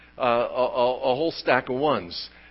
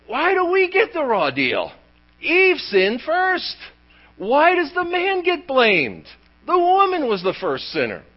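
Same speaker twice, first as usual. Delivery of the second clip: high-pitched, hammed-up protest; mock indignant complaint; 11 semitones higher, 3 dB louder than usual. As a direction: protest like someone unfairly blamed, voice pitched up. Voices the men's indignant complaint.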